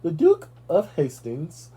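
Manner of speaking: a second, forced English accent attempt. Shown as a demonstration. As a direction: draw out the name in an English accent. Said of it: "Hold on, let me see if I do it".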